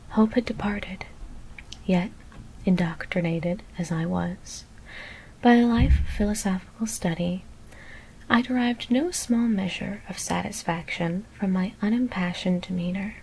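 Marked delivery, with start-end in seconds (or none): none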